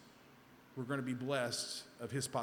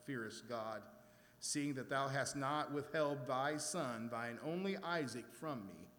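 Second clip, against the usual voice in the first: measured, lower Bible-reading voice; scripture reading; 4 semitones lower, 4 dB quieter than usual. Acted. Preacher reading Genesis to the congregation, solemn and measured. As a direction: read the passage with solemn care.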